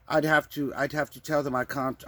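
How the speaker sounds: ordinary talk, nothing marked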